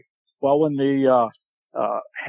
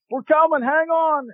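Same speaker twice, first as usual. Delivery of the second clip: high called-back answer; distant reply; 14 semitones higher than usual. Retold or imitated. Buddy answering his call from the swamp, high called reply. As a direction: shout back reassurance from across the swamp.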